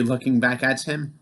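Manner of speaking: usual speaking voice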